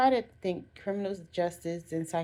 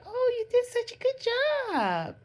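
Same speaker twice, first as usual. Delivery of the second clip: put-on much higher, louder, sugary praise for a child; sweet praising parent; 5 dB louder than usual. Proud parent praising a child's drawing, high and gushing. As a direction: gush over a kid's drawing like a proud parent. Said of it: "whereas your parents say".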